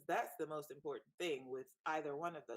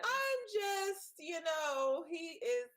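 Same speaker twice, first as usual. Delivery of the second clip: high-pitched defensive; 10 semitones higher, 5 dB louder, much slower than usual. Real, not acted. Defending after being called out.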